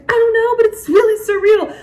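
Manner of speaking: high, loud amazed outburst; excited exclaim. Retold or imitated. Answering everyone's question.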